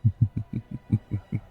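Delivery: drawn-out teasing chuckle; wicked chuckle. Acted. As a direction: chuckle suggestively at your own naughty idea. Suggestive joke to lover.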